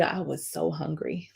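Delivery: I was so hungry said softly, almost to herself; dropped low. Shown as a demonstration. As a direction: sink the voice on so hungry. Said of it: "And when I tell you".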